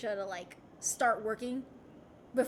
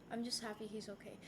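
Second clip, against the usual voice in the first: "I'm just happy he's okay" said much softer, almost to herself; quiet and sincere; 4 semitones lower, 12 dB quieter, faster than usual. Real, not acted. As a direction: let it drop to a soft, sincere murmur. Repeats relief about her dad.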